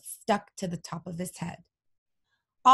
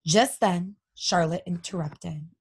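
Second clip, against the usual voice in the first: usual speaking voice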